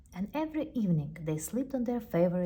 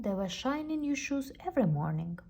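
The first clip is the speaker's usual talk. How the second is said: ordinary delivery, nothing marked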